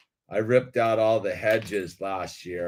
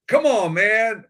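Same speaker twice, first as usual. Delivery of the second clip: exasperated retort; 11 semitones higher, 7 dB louder, slower than usual. Retold or imitated. Retold mocking retort to old timer.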